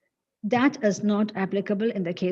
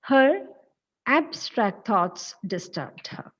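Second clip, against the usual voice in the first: "abstract" after a pause, AB punched; slowly, with "abstract" as AB-stract; stress on first syllable; slower than usual. Shown as a demonstration. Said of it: "the first syllable should be stressed".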